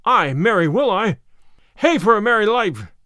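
loud, glad shouting out; joyful cry. A narrator's reading. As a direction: cheer for the new life. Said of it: "cried he".

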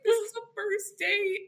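high-pitched mock wail; half-laughing imitation of almost crying; near tears. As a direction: retell it as a high, tearful plea. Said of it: "on the near thing of tears".

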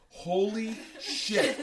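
high, loud, drawn-out amazement; stunned disbelief. Retold or imitated. Driver realising it was a prank, stunned drawn-out exclamation. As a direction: stretch both words out in astonishment.

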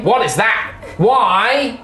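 high nasal mimic of a friend; pinched nose impression. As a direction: squeeze the nose and echo the question. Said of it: "Like the nasally voice".

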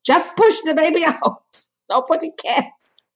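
laughing, brisk mom voice; mom dismissing. Retold or imitated. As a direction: brush the worry off. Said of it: "My mother's like".

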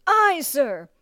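high, strained shout back; recruit's reply. A narrator's reading. Recruit to bellowing drill master, strained shout.

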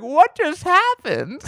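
stunned ladies. Retold or imitated. Old ladies after losing to the chef, slow and stunned. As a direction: let it hang in disbelief. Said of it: "these ladies are like".

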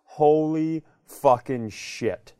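ordinary talk

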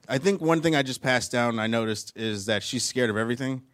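ordinary delivery

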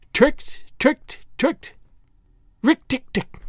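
high, chattering glee; chuckling mongoose. A narrator's reading. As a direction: crow in short, high chirps. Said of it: "chuckled Rikki Tikki".

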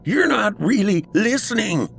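very high, strained outburst; snapping. A narrator's reading. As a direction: snap at him in frustration. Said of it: "he snapped".

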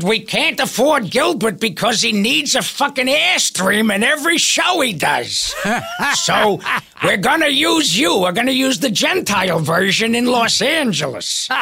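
mock the producers with a shout. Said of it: "they called me and they said".

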